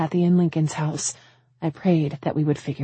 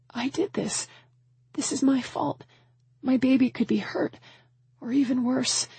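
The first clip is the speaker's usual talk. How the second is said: high, softened anguish; small high voice, near despair; helpless self-blame; 7 semitones higher, 4 dB quieter than usual. A narrator's reading. Mother blames herself for missing child, anguished.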